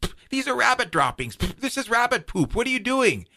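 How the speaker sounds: high-pitched outraged sputter; spitting, disgusted Badger. Badger spits out droppings at Coyote, high indignant sputtering. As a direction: spit it out between indignant words. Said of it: "starts to spit them out".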